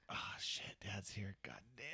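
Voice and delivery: hushed, half-whispered alarm; retold muttered panic; dread under breath. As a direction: curse quietly, as if caught out. Kid spots dad at game.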